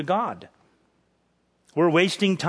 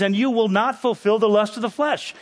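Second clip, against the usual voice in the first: "not fulfill" said higher, with firm emphasis; emphatic negation; 6 semitones higher than usual. Acted. Preacher quoting scripture to the congregation, emphatic. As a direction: press "you will not" home, pitched up. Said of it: "that's an emphatic".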